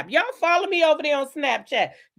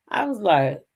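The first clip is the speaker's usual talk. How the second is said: ordinary talk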